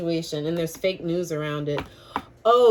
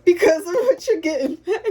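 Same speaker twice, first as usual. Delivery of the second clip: laughing through the words, much higher; words breaking into helpless giggles; 10 semitones higher, 5 dB louder than usual. Real, not acted. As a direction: caught in a real fit of laughter. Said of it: "I'm not laughing at nobody".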